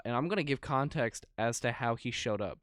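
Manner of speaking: usual speaking voice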